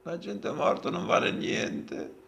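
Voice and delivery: slow whispered repeat; whispering. Retold or imitated. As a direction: breathe the line back softly. Repeating the show's line.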